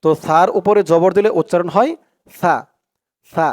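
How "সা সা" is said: সা as a soft "tha", tongue tip at upper teeth; lisped, delicate "tha" for সা; soft thin tha. Shown as a demonstration. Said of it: "সার উচ্চারণটাও একদম নরম পাতলা হবে".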